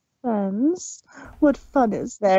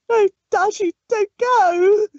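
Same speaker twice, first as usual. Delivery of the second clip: higher, louder desperate plea; crying out; 6 semitones higher, 4 dB louder than usual. Acted. Pinky calling after departing Rainbow Dash, desperate cry. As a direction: beg her not to leave. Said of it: "Pinky cried".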